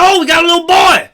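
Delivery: hammed-up proud parent yell; imitating parents. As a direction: play the parent announcing the baby. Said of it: "I'm pretty sure they're like".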